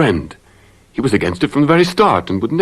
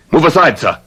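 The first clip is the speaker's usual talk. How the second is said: defiant command; 8 semitones higher, 5 dB louder than usual. Acted. Lover confronts the husband.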